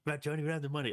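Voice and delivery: high, mock-protesting voice; pleading cartoon character. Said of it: "And then, like, and so".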